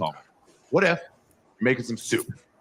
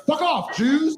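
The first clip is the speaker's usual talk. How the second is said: acted goy yell; slower than usual. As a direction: yell it like a fleeing troublemaker. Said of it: "He's like".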